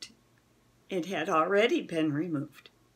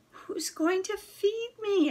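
higher, pleading, with a hint of a whimper; 11 semitones higher than usual. A narrator's reading. Dog pleading with the boy, a hint of a whimper. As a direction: beg for an answer, a little whimper inside. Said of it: "I pleaded, with a hint of a whimper".

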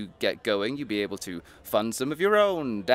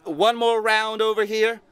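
loud, high call across the room; 11 semitones higher, 6 dB louder than usual. Acted. Paul calls to the bartender for another round, loud and high. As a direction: call to the bartender over the noise.

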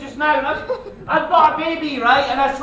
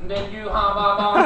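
Stephen Hawking impression; 8 semitones lower, 4 dB quieter than usual. Mocking the bus passenger as a robotic voice. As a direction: drone each word like a speech computer. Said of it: "The guy sounded like Steve Hawking".

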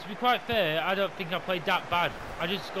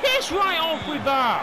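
slow furious yell; 11 semitones higher, 8 dB louder, slower than usual. Real, not acted. Gamer cursing the game, slow furious yell. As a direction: drag it out, loud and high with rage.

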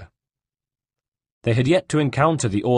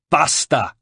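loud, higher outburst; crying out; 6 semitones higher, 5 dB louder than usual. A narrator's reading. Caterina stops the brothers' taunting, crying out. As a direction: cut him off with a raised cry. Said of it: "cried Caterina".

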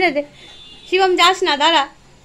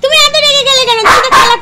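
cooing reproach to the dog; pet talk; 8 semitones higher, 6 dB louder, faster than usual. Real, not acted. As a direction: soothe a sulking pet, higher and louder. High pet talk to angry dog.

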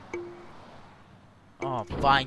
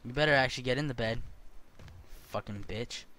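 usual speaking voice

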